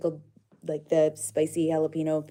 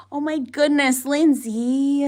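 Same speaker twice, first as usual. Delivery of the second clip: much higher, louder stunned exclaim; overwhelmed surprise; 9 semitones higher, 10 dB louder, slower than usual. Real, not acted. Streamer reacting to a viewer's big donation, stunned exclaim. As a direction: let the surprise burst out.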